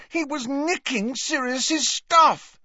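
high, slow, strained shout; indignant high-pitched yell; angry outburst. A narrator's reading. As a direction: burst out in outrage. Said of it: "Harry burst out".

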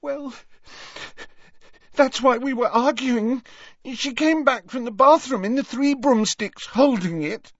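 high, distraught voice building to a wail; tearful sobbing. A narrator's reading. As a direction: explain through rising sobs, upset. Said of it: "Leanne shook with renewed sobs".

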